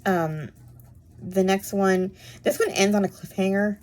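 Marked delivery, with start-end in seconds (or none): none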